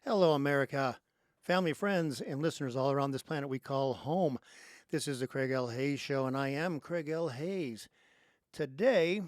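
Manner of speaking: loud, punchy host introduction; radio show opening. Real, not acted. Host welcomes listeners to his show, loud announcer delivery. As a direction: greet the whole audience at full volume.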